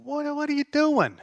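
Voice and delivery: high, baffled mock question; incredulous. God asking the nations, high and incredulous. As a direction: ask it in disbelief. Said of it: "kind of in an incredulous manner".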